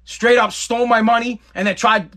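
plain reading voice

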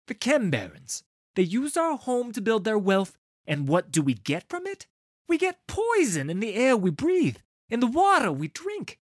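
loud, high, impassioned tirade building to a furious cry; rising, sharpened voice. A narrator's reading. Leader denouncing the barons to the gathering, voice rising in anger. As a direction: whip the crowd up, hammering each grievance. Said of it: "His voice begins to rise, its edge sharpening".

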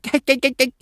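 put-on high-pitched baby gibberish; imitating a babbling toddler; toddler babble. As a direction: babble like a little one.

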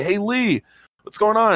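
high, loud friendly hail; calling out. Retold or imitated. Fan calling to his favourite driver at the pit box, high and loud.

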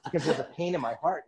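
high laughing agreement; amused, still laughing. Real, not acted.

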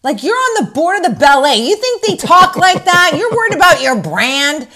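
loud, high mock indignation; mocking rant. Host mocking Kyle's ballet snobbery, loud indignant mock rant.

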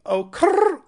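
high, loud tongue trill on "crrr". Retold or imitated. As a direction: inexact imitation of a rapper's rolled catchphrase. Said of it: "I'm doing more tongue".

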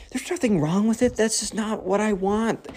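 soft, high, hushed protest; whispering back. Retold or imitated. Carter answering the girl in math class, whispering back. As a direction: defend yourself under your breath. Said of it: "whispers back".

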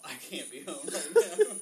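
laughing through a panicked plea. Retold or imitated. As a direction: beg to be picked up while cracking up. Retold panicked call, laughing.

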